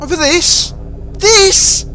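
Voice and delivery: mock outrage at the very idea; indignant disbelief. [name] scoffs at Ed's peace offering.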